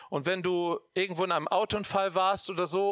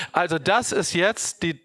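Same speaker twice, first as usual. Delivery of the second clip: louder preacher's announcement to the church; preaching at full force; 7 dB louder than usual. Acted. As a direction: proclaim it to the whole room. Loud preaching to the congregation.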